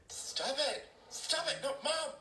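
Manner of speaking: put-on whining kid in the backseat; whiny tattling little sibling. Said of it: "family vacation backseat simulator".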